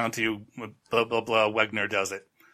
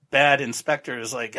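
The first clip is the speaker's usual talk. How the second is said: normal talking voice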